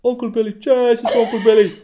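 high, exclaiming Dublin accent. Shown as a demonstration. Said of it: "But Dublin".

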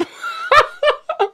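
high cackling laugh; high laughter. Real, not acted. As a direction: shriek with laughter. Laughing hard.

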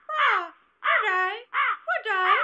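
high, loud cawing crow voice; loud bird-like greeting. A narrator's reading. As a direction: caw it out like a big crow, drawn out. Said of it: "and now it said".

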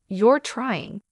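plain reading voice

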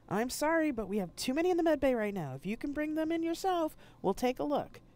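med bay staff brushing off the call over the comm. Acted. Med bay answering over channel.

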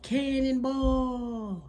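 high, drawn-out gleeful yell; cannonball shout. Acted. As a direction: shout it as you leap into the pool. Kid jumping into pool.

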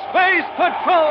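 slow, high ringing shout of the title. Acted. Announcer calling out the show's name in a slow high shout. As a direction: stretch out the name like a fanfare.

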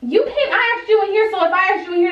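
high-pitched indignant retort. Real, not acted. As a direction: voice jumps high, sharp and insistent. Confronting a housemate, telling her off.

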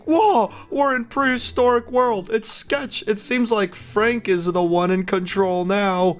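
high, excited reading of an NPC line; excited shout. Retold or imitated. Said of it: "This guy is screaming".

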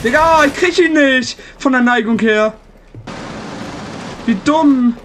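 loud exasperated complaint; frustrated shout. Real, not acted. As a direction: vent it loudly. Frustrated during the round.

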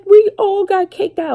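high, loud tearful whine mimicking her daughter; imitating crying teen. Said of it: "She starts crying".

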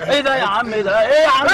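panicked shout. Real, not acted. Passenger panicking at strange act.

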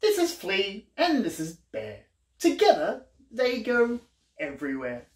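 lively, sing-song picture-book reading; reading to children. A narrator's reading. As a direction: read it like a bedtime book for little ones.

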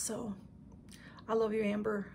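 ordinary talk, nothing marked

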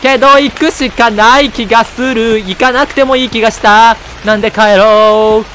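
slow, loud, high dramatic reading; hammed-up narration. Player reading the hero's lines, slow, loud and high. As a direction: read the game text like a ham actor. Reading game text in put-on voice.

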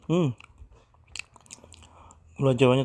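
chewing while speaking; words muffled by food; mouth full. Real, not acted. Seen 3: bites and chews brown cake, cheeks full, then talks.

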